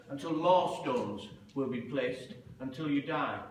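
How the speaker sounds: a judge dooming the prisoner; solemn death sentence. Retold or imitated. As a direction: intone the sentence gravely from the bench.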